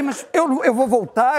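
high, stunned disbelief; hammed-up shock at being deported; he answers the clerk, high and stunned. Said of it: "Eu falei".

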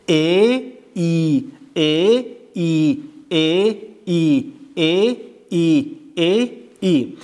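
ا then ای, each long; teacher contrasting ا and ای; slow vowel drill. Shown as a demonstration. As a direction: stretch ا and ای apart.

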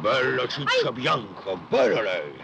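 lento e più forte, da cascamorto; mock flirt. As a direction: fischio a parole, lento. Fa il cascamorto, prende in giro. (tono da cascamorto, lento e più forte)

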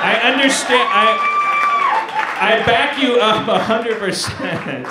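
loud, high, laughing reassurance; laughing shout. Real, not acted. To the Texan friend, a loud laughing apology.

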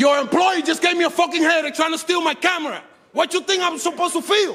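real indignant outburst, pitched high; angry yelling. Real, not acted. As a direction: yell the complaint, high and heated. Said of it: "yelling at me".

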